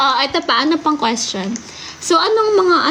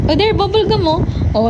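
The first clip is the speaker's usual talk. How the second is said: normal talking voice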